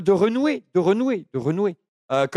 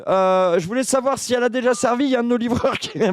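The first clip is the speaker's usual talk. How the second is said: cheeky delivery man voice. Acted. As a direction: play an absurd chirpy delivery man. Second prank call, delivery man.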